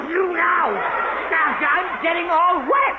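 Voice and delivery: loud, drawn-out protest; complaining loudly about getting soaked; slow soaked shout. Acted. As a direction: drag it out while getting hosed. Archie sprayed by the hose.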